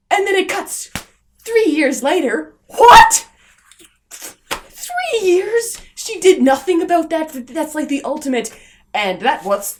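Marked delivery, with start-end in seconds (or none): 1.4-2.5 louder, a laugh through the words; laughing disbelief. Real, not acted. Reviewer mocking the time-skip caption, laughing loudly. 2.7-5.8 much higher and louder, shrieked; shocked disbelief. Real, not acted. Reviewer stunned by the three-year jump, shrieking.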